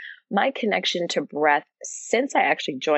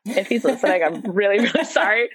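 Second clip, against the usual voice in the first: sheepish, giggling sorry; laughing apology; 3 semitones higher than usual. Real, not acted. Laughing apology to UPS guy.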